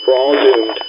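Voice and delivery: normal talking voice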